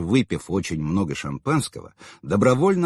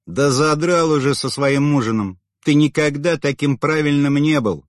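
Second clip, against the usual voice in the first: loud irritated snort; scornful snort; 5 semitones higher, 4 dB louder than usual. A narrator's reading. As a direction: snap it out with scorn. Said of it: "фыркнула она".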